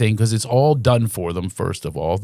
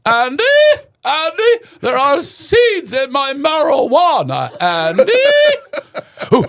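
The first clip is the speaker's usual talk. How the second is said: much higher, shouting and giggling deputy; Barney Fife; 3 dB louder, slower than usual. Retold or imitated. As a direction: squeal at Andy in a panic, then cackle high. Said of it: "You know, Barney Fife".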